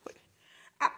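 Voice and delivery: normal talking voice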